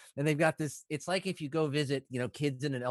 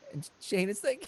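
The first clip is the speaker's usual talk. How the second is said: ordinary delivery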